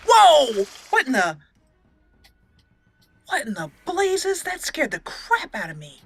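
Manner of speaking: ordinary delivery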